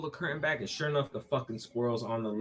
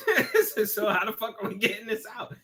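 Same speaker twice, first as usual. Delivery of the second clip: incredulous; 8 semitones higher, 5 dB louder than usual. Retold or imitated. Incredulous retold question.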